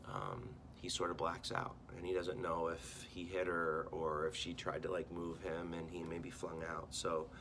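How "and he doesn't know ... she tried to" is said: usual speaking voice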